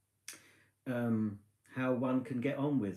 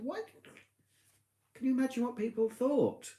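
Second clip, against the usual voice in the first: high-pitched mock scolding; telling off; 12 semitones higher than usual. Wife scolding husband about campsite neighbours, high pitch. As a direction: scold him in a much higher voice.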